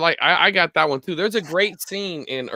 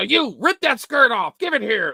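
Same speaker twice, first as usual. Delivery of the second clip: barked order, higher and louder; retold yell; 8 semitones higher, 5 dB louder than usual. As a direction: bark the order at her like a man in charge. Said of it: "And he yells at this lady".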